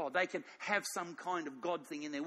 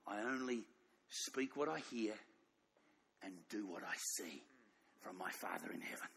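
solemn hushed quote; 10 semitones lower, 10 dB quieter than usual. Acted. Preacher quoting Jesus to the congregation, low and hushed. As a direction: let the linchpin fall to a near hush. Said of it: "See, Jesus said this".

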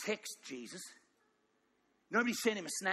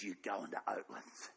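much lower, breathy whisper of God; whispered Father; 11 semitones lower, 8 dB quieter than usual. Acted. The Father telling Jesus where to go, in a whisper. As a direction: whisper the command like a secret. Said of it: "And the Father said".

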